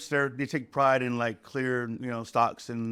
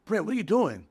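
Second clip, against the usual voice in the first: higher-pitched, mildly angry challenge; imitated angry tone; 5 semitones higher than usual. Newer cook challenging Brent over his prep, imitated angry tone. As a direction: mimic an irritated cook calling someone out. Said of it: "Very angry tone".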